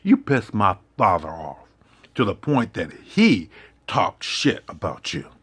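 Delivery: normal talking voice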